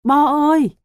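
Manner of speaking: loud, high wailing call; desperate cry. A narrator's reading. Father losing hope for his son, wailing cry. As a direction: cry out the name, near tears. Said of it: "Tiếng bố Bo".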